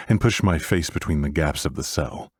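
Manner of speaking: plain reading voice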